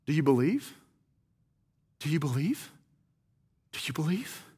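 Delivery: soft and low, fading to a whisper; hushed question. Acted. Jesus hits them with a question, hushed.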